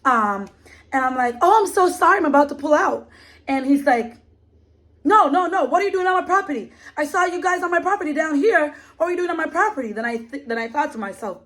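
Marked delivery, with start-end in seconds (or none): 1.4-3.1 apologetic. Retold or imitated. Her apologetic reply retold. 5.0-9.9 fast, high mock angry man insisting. Retelling the landowner repeating his demand, fast high mock anger.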